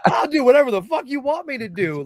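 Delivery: put-on high, loud fan-boy eagerness; overexcited yell. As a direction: shout an ecstatic yes through laughter. Acting out his eager answer.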